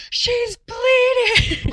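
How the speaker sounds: very high, loud, drawn-out alarm; panicked alarm. Retold or imitated. Imagined nurse raising the alarm, panicked.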